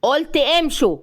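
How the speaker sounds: angry, insistent command; angry interruption. A narrator's reading. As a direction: cut her off with an angry repeated order. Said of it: "هو قاطعها بغضب".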